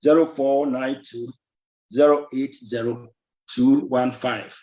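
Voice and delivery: each digit spaced out slowly; dictating a phone number digit by digit; slow, careful repeat. Shown as a demonstration. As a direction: read the number slowly so callers can write it down. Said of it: "Mebɔ number no bio".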